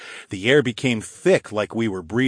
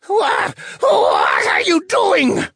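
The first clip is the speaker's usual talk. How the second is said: high, loud, breathy distorted shriek; distorted wraith voice; 6 dB louder, much slower than usual. A narrator's reading. Trapped wraith fails to change form, distorted shriek. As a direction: screech it, dragged out and distorted. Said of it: "The wraith's voice was distorted".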